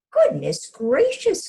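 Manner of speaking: high, loud, slow gasp of alarm; a hen shocked out of her wits; alarmed cry. Acted. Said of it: "cried Hen".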